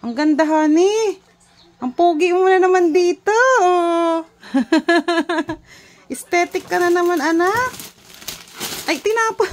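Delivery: high, loud and excited. Real, not acted. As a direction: gush over the gift. Parent praising baby excitedly.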